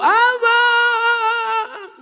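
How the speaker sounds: high sung wail, hammed-up grief; mock wail. Preacher imitates a widow crying at the grave, sung wail. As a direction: stretch the cry out, half-mocking.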